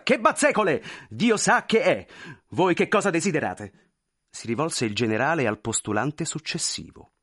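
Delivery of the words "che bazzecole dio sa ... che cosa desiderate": louder, higher, dismissive official; brusque general. Acted. General brushing the clerk aside before the next petitioner, brusque. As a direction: wave it off sharply.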